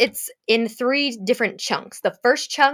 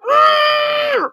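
sharp loud high gasp of shock; 5 dB louder than usual. Real, not acted. Reading Manacled's ending, a shocked loud gasp. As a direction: gasp like betrayed by a book.

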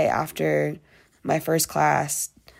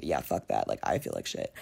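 low fed-up grumble; 9 dB quieter than usual. Retold or imitated. Voicing a fed-up inner thought.

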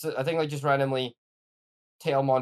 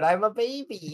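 put-on baby voice; 7 semitones higher, slower than usual. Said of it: "I'm tired of carrying you around".

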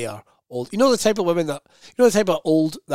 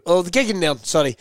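normal talking voice

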